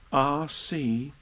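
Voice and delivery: ordinary delivery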